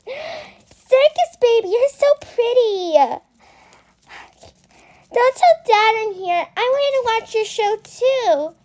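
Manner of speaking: put-on high, excited little-girl voice; Elizabeth. Shown as a demonstration. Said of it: "I give you my Elizabeth accent impression".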